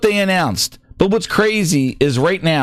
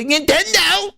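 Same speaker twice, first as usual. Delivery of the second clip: higher, sing-song mock fanboy; 9 semitones higher than usual. Streamer mocks Xbox fans' talk, high sing-song parody. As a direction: parody the gushing fans, pitched up. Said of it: "they talk like this".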